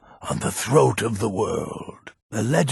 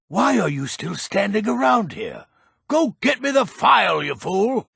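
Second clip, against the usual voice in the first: high, exasperated snap at a dawdler; angry yell; 9 semitones higher than usual. Acted. Old alchemist yelling at the idle adventurer, angry. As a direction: shoo the fool off, impatient. Old man scolds the player.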